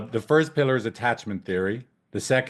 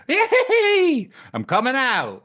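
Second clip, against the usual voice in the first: very high laughing yay; giggly high squeal, then fading; newborn cheer; 5 dB louder, slower than usual. Pretend. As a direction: cheer out like a baby being born. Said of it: "It's like a little helmet".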